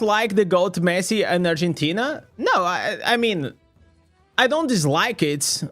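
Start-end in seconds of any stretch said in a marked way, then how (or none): none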